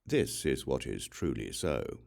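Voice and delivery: usual speaking voice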